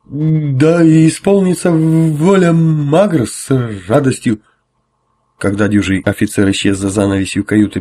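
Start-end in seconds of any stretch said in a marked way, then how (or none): 0.1-4.4 high, slow, booming formal address; pompous officer. Acted. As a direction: draw out the grand greeting. Officer answers with zealous ceremony.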